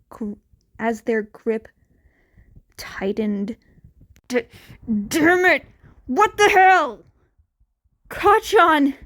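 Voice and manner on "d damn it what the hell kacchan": higher, much louder stammered cry; shocked outburst. A narrator's reading. Teen cries out seeing his captured friend, a horrified shout. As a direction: yell it out in horror.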